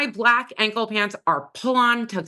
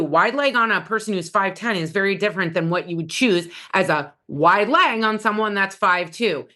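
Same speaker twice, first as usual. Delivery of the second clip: usual speaking voice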